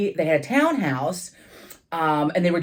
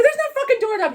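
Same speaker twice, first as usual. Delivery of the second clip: much higher, exasperated snap; exasperated retort. Retold or imitated. Trapped guest snapping at her friend, exasperated. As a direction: fire back in disbelief.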